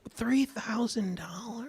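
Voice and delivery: stunned whisper, slowly; shocked whisper. Real, not acted. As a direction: whisper the price in disbelief. Shocked at price.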